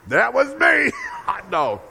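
high, loud crowing shout; triumphant boast. Acted. Parent claiming credit for fixing the teeth, triumphant shout. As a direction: crow it out, hammed-up and high-pitched. Triumphant answer in acted list of favors.

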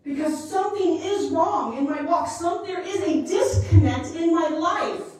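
loud, rising preacher's cry; loud, climbing preaching; full-force preaching. Acted. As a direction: preach it out with mounting urgency. Preaching with building intensity.

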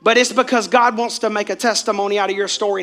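usual speaking voice

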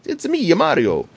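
put-on high Italian-accent Mario catchphrase; Mario impression. Father teaching his daughter fake Italian, high Italian-accent Mario. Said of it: "You actually did say real Italian there".

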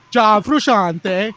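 John Frusciante drawn out slowly, loud and high, froo-SHAHN-tay; hammed-up, loud Italian John Frusciante; exaggerated Italian. Shown as a demonstration. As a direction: savor the name, slow and grand, Italian style. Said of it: "say it like that from now on".

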